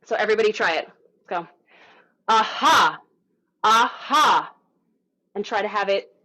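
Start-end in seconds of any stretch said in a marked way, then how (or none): 2.3-4.5 loud two-part aha with a bursting ha; projected vocal warm-up aha; anchored breathy aha. Shown as a demonstration. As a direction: send the ha out on the breath. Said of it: "as anchored low as possible".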